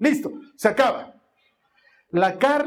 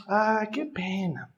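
regretful travel agent; 3 semitones lower, 5 dB quieter than usual. Retold or imitated. Imitating travel agent to dad turning down the park, pitying. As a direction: sigh it with gentle regret. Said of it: "la de la agencia de viajes me dice".

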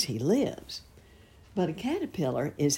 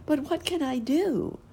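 pleading caterpillar; 13 semitones higher than usual. A narrator's reading. Perky pleading.

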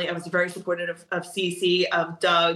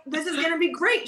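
much higher, bubbly promise; gleeful; 10 semitones higher than usual. Retold or imitated. To her nervous student, much higher gleeful promise. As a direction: sell the fun with bright enthusiasm. Excited about competing with student.

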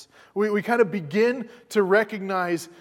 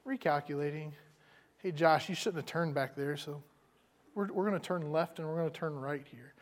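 soft, patient calm reply, quieter; gentle redirecting voice; 8 dB quieter than usual. Retold or imitated. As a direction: guide him back kindly, no reproach. Gentle God like GPS.

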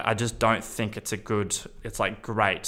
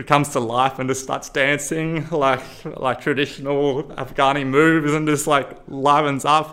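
livelier, higher and louder as he lights up; excited retelling; 6 semitones higher, 5 dB louder than usual. Real, not acted. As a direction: caught up in the joy of it. Describes man dancing joyfully.

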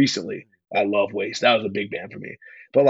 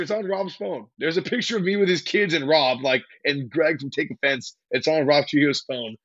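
normal talking voice

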